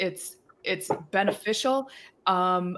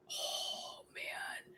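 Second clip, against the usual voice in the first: breathy, very soft mock sigh; awed whisper; 16 dB quieter than usual. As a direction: let it out on a whispered breath, imitating a son. Acting out her thanked son's reaction.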